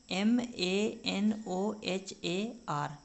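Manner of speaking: letters spaced out slowly; slow spelling. Shown as a demonstration. Teacher repeats the full spelling slowly. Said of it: "Manohar".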